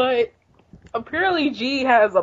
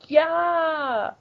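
mock battle cry; 7 semitones higher than usual. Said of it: "we hear somebody saying".

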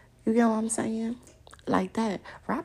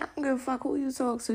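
usual speaking voice

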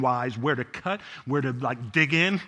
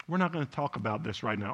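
quick, much softer mock of the wife shutting it down; imitating wife, hushed; 8 semitones lower, 13 dB quieter, faster than usual. Pastor imitating his wife ending the argument, quick and hushed. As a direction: clip it off low and fast, like she's ending the talk. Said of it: "And she was like".

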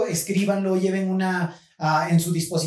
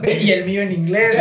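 laughing; 2 semitones higher, 5 dB louder than usual. Real, not acted. Laughing about his English accent.

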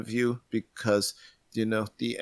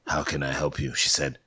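low, hard-boiled narration; noir detective; 8 semitones lower, 3 dB quieter than usual. Acted. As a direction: narrate like a film noir gumshoe.

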